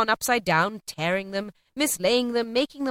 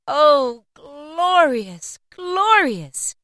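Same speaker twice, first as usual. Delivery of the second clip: high, ringing exclamation; drawn-out elated wonder; rapturous delight; 7 semitones higher, 6 dB louder, much slower than usual. A narrator's reading. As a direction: cry it out, overcome with joy. Scrooge rejoices at the morning.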